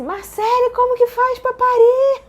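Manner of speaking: high, loud, drawn-out plea; re-enacted shriek for help; retold desperate cry. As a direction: wail it to the doctor, hammed up. Re-enacts cry to her doctor.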